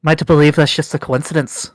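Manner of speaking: stern, accusing dwarf matriarch; suspicious dwarf leader. Acted. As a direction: press them hard with suspicion. NPC dwarf leader interrogates.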